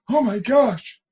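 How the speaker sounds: mock shocked cry, voice jumping high; retold shocked gasp. Crowd reacting to sudden punch.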